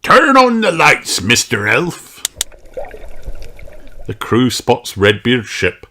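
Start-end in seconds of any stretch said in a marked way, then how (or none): 0.0-2.2 high, loud pirate order; captain's order. Acted. Redbeard orders the lights on.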